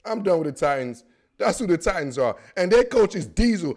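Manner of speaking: ordinary talk, nothing marked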